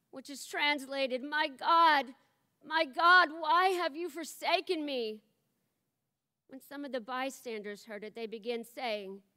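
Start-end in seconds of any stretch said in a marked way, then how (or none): 1.2-5.2 higher, louder pleading cry. A narrator's reading. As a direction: cry it out, still anguished. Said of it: "cried out with a loud voice".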